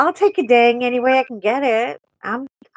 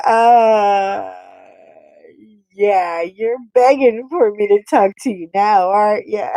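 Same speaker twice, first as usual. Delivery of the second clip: louder smug taunting; smug mock retort; 4 dB louder than usual. Wife taunting begging husband, smug. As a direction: gloat a little.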